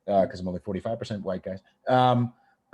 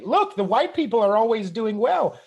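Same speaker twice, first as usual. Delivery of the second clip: mocking leftists; 12 semitones higher, 6 dB louder than usual. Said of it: "dumb American leftists point to and say".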